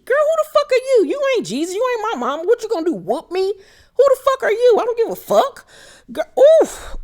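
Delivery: high, heated, fast indignant rant; outraged rant. Real, not acted. Recapper telling Lisa off from the couch, high outraged rant. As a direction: tell her off like you're in the room. Ranting at the cast member.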